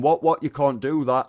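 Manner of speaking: shocked outcry. Retold or imitated. Retells rivals' shocked outcry.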